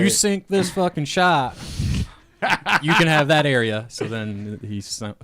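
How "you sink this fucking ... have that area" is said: acted wager. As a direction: lay down the bet like a hammed-up mayor. Voices a mayor making a bet.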